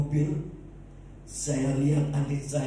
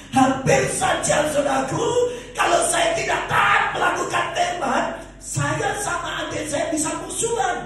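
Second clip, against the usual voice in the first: full-force preaching; 6 dB louder than usual. Real, not acted. Preacher urging believers to keep harmony, full-force preaching. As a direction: drive the moral home to the congregation.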